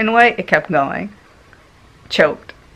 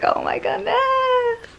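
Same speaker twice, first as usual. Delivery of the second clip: very high, slow, squeaky oh my goodness; disbelieving squeal at her veins; mock exasperation; 4 dB quieter, slower than usual.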